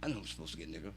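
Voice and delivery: low, soft, offhand delivery; understated, low-key feigned aloofness; playing aloof. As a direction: toss it off quietly, feigning indifference. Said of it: "just playing aloof".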